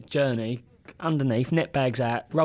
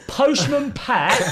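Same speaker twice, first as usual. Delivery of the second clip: much higher, slow and louder; drawn-out cheeky chant, pitched up; bold sing-song; 10 semitones higher, 5 dB louder, much slower than usual. Retold or imitated. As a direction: call it right in his face. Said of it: "went straight up to his boat".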